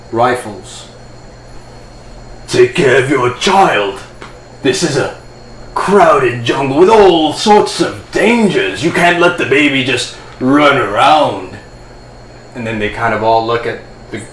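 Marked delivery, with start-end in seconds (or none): 2.5-11.6 blustering hippogriff in military uniform, swooping pitch; scolding hippogriff officer. Acted. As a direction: scold a careless parent, stretching the big words.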